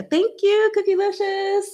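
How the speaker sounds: very high-pitched, sing-out thanks; squeaky thank-you. Real, not acted. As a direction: thank a viewer in a tiny high voice. Thanking a viewer.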